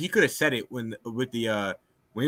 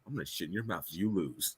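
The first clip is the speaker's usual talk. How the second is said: Kyler's dad threat; 9 semitones lower, 18 dB quieter than usual. Retold or imitated. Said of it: "Kyler's dad's like".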